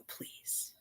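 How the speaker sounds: a soft breathy whisper; whispered please. Real, not acted. Quietly asking viewers to correct misinformation, whispered. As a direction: whisper it like a secret ask.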